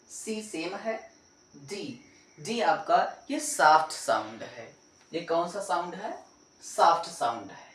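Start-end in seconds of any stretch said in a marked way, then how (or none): none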